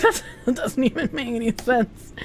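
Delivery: laughing disbelief. Retold or imitated. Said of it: "I'm just like".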